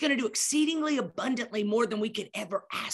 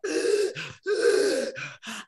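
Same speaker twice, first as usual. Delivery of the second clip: gasping for air; 10 semitones higher than usual. Retold or imitated. Retelling the hospital visit to Cammie, a dramatic gasp for air. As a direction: gasp like you can't breathe. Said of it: "I'm like".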